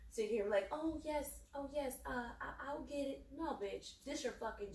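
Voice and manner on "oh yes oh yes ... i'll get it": higher, meek eager-to-please voice; exaggerated obedient yes-woman; mock ass-kissing. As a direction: put on servile compliance, mockingly. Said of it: "I'm not gonna kiss your ass".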